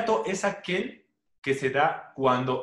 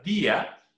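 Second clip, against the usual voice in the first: "día" with the voice lifted on the í; acento en la I; 4 semitones higher than usual. Shown as a demonstration. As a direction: stress the í in "día", splitting it from the a. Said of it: "La elevación de voz cae sobre la vocal débil I". ("día" con la elevación de voz en la í)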